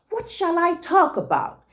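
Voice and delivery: bright eager question, lightly voiced; eager rabbit. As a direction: ask it eagerly, like a proud little rabbit. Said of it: "he asked eagerly".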